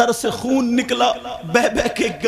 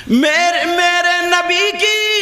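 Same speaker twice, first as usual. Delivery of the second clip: loud, raised storytelling sermon; impassioned religious storytelling; fervent preaching; 9 semitones higher, 6 dB louder than usual. A narrator's reading. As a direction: preach the story at full force to the crowd.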